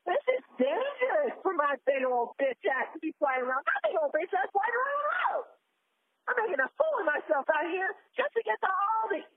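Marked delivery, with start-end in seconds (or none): 3.7-5.4 high-pitched indignant shout; worked-up, high yelling; exasperated outburst. Acted. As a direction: repeat it in a rising, outraged holler. Exasperated repeat of the phrase.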